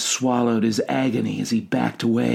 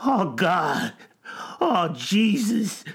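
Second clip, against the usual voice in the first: high, drawn-out wail; acted anguish, pitched high; anguished cry; 7 semitones higher, slower than usual. A narrator's reading. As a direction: wail it in dread. Stranger falls to knees, slobbering.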